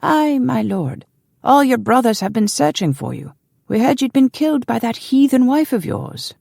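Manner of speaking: put-on high-pitched old servant's greeting; old man. A narrator's reading. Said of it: "said an old man".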